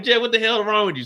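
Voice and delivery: high, loud worried question; alarmed shout. Retold or imitated. Narrator worried about sick Jeff, loud high call.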